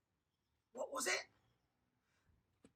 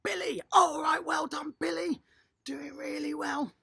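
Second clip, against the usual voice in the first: cheering; 5 semitones higher, 6 dB louder than usual. Real, not acted. Coach cheering a child by name.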